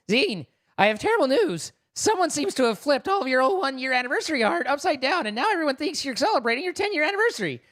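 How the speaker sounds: high-pitched character read; hammed-up high delivery of bad news; put-on message voice. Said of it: "read my message in a, in a voice".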